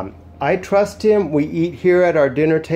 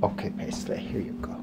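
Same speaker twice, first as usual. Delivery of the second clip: soft whispered treat offer; whisper to dog; 3 semitones lower, 11 dB quieter than usual. Real, not acted. Handing Paisley a treat, in a whisper. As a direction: breathe it to the dog as you hand over a treat.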